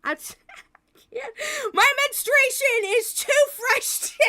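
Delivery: high, wailing laugh-cry. Real, not acted. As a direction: break down laughing and crying. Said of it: "I can't handle this today".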